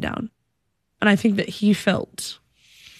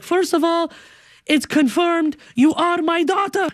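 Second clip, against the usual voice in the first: proud dad; 9 semitones higher than usual. Retold or imitated. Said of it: "He's like".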